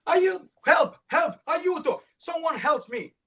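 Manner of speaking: Red panicking, suddenly covered in yellow; panicked cry for help. Acted.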